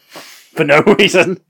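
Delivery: slow, high and loud through laughter; cracking up at the pointless plot; laughing. Real, not acted. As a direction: laugh it out in disbelief.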